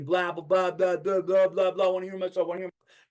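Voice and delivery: high, droning run of blahs; mock windbag. Mocking a narcissist giving a speech, self-absorbed rambling. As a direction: imitate someone in love with their own voice. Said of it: "Don't do it to be a fucking narcissist, to hear yourself talk".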